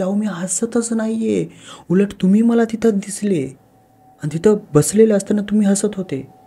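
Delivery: a scared wife who can't see her own smile; panicked insistence, voice climbing; frightened protest. Acted. Terrified wife insists to husband.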